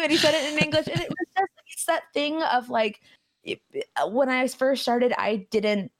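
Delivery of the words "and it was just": ordinary delivery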